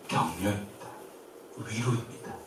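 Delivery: usual speaking voice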